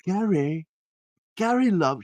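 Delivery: put-on light English accent, calling gently through the door; a hint of an English lady fussing; English woman. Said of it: "she was English".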